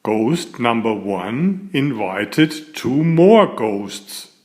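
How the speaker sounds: usual speaking voice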